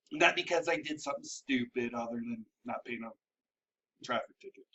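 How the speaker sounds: very low, very soft trailing voice; muttered aside. Real, not acted. Host explaining the unpaid ticket, muttered aside. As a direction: sink right down under the breath.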